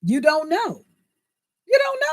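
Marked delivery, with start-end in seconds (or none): 1.7-2.0 much higher shouted repeat. Real, not acted. Repeating to viewers, now shouting. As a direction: burst out with it the second time.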